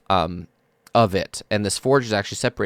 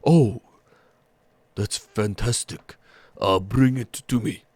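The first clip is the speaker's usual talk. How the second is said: slow, welcoming big ogre voice; gentle ogre smith. Acted. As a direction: greet them warmly, slowly, like a giant.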